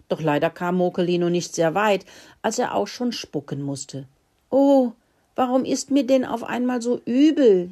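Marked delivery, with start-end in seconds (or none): 4.5-7.7 high, louder queasy whine; whining. Acted. Sick little mouse whines to his sister, high and moaning. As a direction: moan that his stomach turns. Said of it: "jammerte er".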